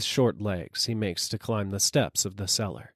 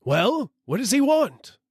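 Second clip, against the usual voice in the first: much higher, louder shout; 14 semitones higher, 5 dB louder than usual. A narrator's reading. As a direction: call up from the cellar. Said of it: "shouts the dealer".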